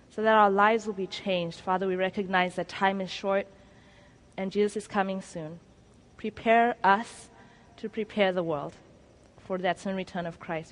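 soft, hushed closing prayer; a quiet earnest prayer winding down to amen. Acted. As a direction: pray it quietly, head bowed. Closing prayer before the sermon.

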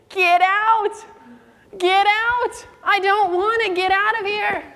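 high mock shout of get out; imitating the angry woman yelling get out. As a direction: drive them off the porch. Woman shouting visitors away.

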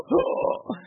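high, sucked-in imitated gasp; mock gasp for breath. Patient answering the doctor, mock gasp for breath. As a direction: gulp for air as if unable to breathe. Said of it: "Why can't you breathe?".

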